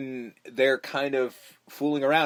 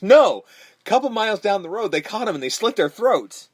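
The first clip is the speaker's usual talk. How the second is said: loud and higher, mock grim; loud retold punchline; 7 semitones higher, 6 dB louder than usual. Friend retelling a film scene, loud mock menace. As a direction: blurt the grisly twist out big. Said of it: "And he goes".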